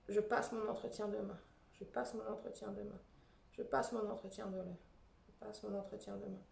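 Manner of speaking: soft tapping mantra. Shown as a demonstration. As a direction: say it gently to yourself while tapping. Repeats the tapping phrase. (mantra répété plus doucement)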